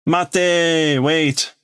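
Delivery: high call of wait. Acted. Kid calls after Shibugaki to wait, high call. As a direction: shout after him to stop.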